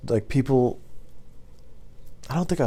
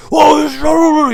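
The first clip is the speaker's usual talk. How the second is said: high, garbled mock yell; drunken screaming; 9 dB louder than usual. Drunk customer screaming at the driver, imitated as garbled yelling. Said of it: "he's screaming at me. He's drunk".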